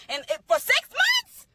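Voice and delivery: can you believe this; incredulous. Real, not acted. Indignant to the listeners about his six months, high incredulous question. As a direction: pitch shoots way up, slow and disbelieving.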